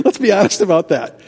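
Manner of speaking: normal talking voice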